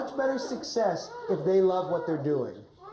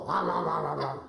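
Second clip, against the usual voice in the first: mock wolf growls; 5 semitones lower than usual. Shown as a demonstration. Coach showing the wolf eating Red, playful growls. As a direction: growl again and again like a hungry pretend wolf. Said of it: "And Wolf, can you go".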